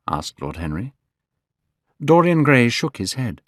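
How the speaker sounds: ordinary delivery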